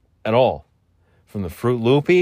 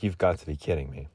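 muttered disbelief; 6 semitones lower, 9 dB quieter, faster than usual. Retold or imitated. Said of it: "I'm like".

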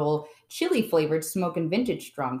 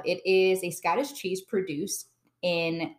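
ordinary talk, nothing marked

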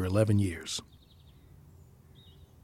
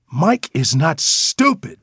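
higher, louder, slow and emphatic; firm rebuke; 6 semitones higher, 5 dB louder, slower than usual. Acted. Mother correcting daughter about her brother, firm rebuke. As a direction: defend him, spacing out each word.

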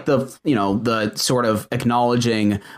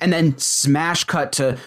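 ordinary delivery

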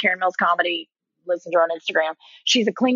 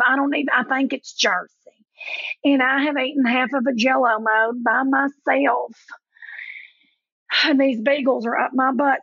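Leanne; 4 semitones higher than usual. Retold or imitated. Comic mimics her friend calling from bed, thick Southern accent. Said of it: "I'm gonna do her voice for you".

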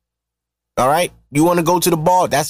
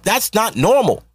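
normal talking voice

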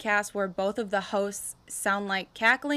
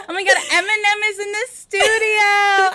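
high, loud laughing shriek; mock fangirl screaming, high and loud; 12 semitones higher, 10 dB louder than usual. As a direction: shriek like a starstruck fan.

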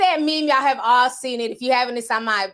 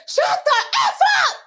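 put-on much higher, loud defiant kid shout; with his whole chest; 3 dB louder than usual. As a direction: yell it with full confidence. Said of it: "he said it with his whole chest".

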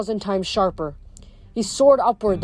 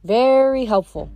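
drawn-out, higher mock gratitude; sarcastic; 4 semitones higher, 4 dB louder than usual. A narrator's reading. Frank thanks Death for doing nothing, sarcastic. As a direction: stretch it out with dry sarcasm.

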